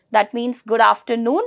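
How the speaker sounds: normal talking voice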